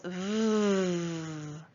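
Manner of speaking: V as a long buzzing sound; sustained vvv, not the letter name; isolated sound. Shown as a demonstration. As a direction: hold the v on its own. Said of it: "What letter makes that sound?".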